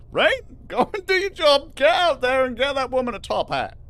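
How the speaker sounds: loud, high, laughing push to go do it; high excited rallying call; excited urging. Real, not acted. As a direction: cheer them on, giddy and loud. Urging chat to get top hat.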